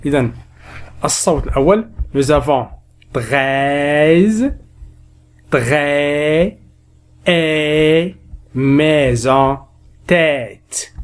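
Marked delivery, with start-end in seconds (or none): 3.1-10.9 "treize", "très", "maison", "tête" with the e held long; drawn-out open e. Shown as a demonstration. Teacher reviewing sounds, "treize", "très", "maison", "tête" slowly drawn out. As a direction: stretch the open e in each word. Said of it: "je révise les sons".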